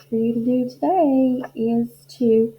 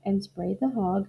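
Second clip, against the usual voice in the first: plain reading voice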